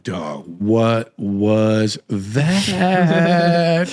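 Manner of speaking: very slow, drawn-out astonishment; stunned disbelief. Retold or imitated. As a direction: stretch each word out, dumbfounded, the last one longest. Retells stunned reaction in parking lot.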